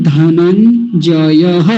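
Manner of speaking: धनंजयः slowly, ending in a breathed ha; visarga ending. Shown as a demonstration. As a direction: stretch dha-nan-ja-ya-ha, धनंजयः. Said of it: "विसर्ग भी है साथ में". (विसर्ग के साथ धीमा उच्चारण)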